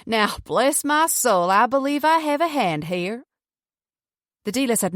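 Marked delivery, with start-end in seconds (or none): 0.0-3.2 put-on slow, stretched-out Southern US accent. A narrator's reading. Boy announcing his poker hand, slow Southern US accent. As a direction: draw it out like a cocky Southerner. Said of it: "His southern U S accent was easier to make out".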